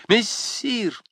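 high, eager call of the title; impetuous call. A narrator's reading. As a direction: rush in with it decisively. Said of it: "Со стремительностью воды". (стремительно, решительно)

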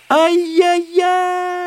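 high, loud, drawn-out yelp; yelping cry. Acted. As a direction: cry out as you're knocked flying. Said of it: "закричал незнакомец".

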